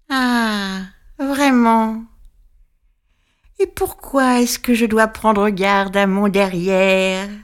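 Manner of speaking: pointed little witch voice, slow; the witch catching him out, sour and mean; sour, spiteful witch. Acted. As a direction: sneer it like a nasty little witch. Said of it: "une petite voix pointue. Aigre, méchante".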